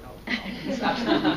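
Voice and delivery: usual speaking voice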